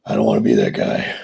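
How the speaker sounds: dropped far down in pitch; low voice. Pretend. Streamer rejecting being that guy, mock deep voice. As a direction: refuse in a comically deep voice.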